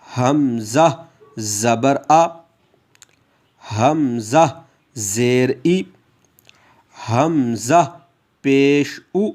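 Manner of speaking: slow, drawn-out teacher drill; teacher reciting slowly for students to repeat; slow drill recitation. Shown as a demonstration. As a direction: stretch each sound for students to repeat. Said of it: "आप मेरे साथ दोहराएंगे".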